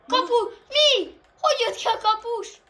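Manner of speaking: high, loud stunned outcry; can't believe what just happened; shocked disbelief. Real, not acted. Shocked by goalkeeper goal.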